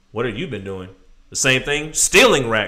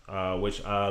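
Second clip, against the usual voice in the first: ordinary delivery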